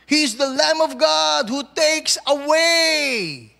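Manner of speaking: loud, slow preaching; preaching at full force. Acted. Pastor proclaiming to congregation, loud and slow. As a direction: proclaim it to the whole church.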